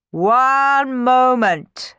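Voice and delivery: high, loud, very drawn-out call; commanding interruption. A narrator's reading. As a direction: halt everyone with a long, slow call. Halting the departing party.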